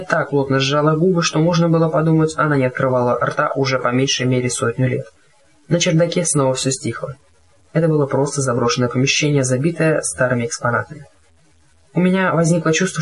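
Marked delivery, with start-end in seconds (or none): none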